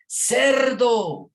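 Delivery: high, loud yell at a passing car; shouted insult. Retold or imitated. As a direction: yell it out to a driver speeding past. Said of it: "le grita al carro que va pasando".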